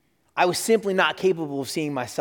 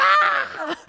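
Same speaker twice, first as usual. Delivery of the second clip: mock crowd cheer; 3 dB louder than usual.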